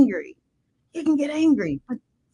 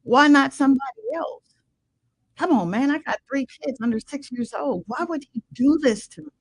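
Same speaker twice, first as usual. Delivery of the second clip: retold angry protest; 8 semitones higher, 3 dB louder than usual. Retelling her angry protest to God.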